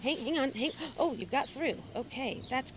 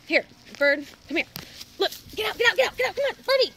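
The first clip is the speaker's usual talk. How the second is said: urgent coaxing; 9 semitones higher, 9 dB louder, faster than usual. Real, not acted. Coaxing the bird, rising urgency.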